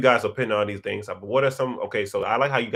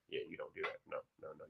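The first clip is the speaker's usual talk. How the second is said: softer, whispered repeat; 7 semitones lower, 22 dB quieter, much faster than usual. Acted. Scolding the professor, hushed whispered repeat. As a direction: drop to a whisper to drive it home.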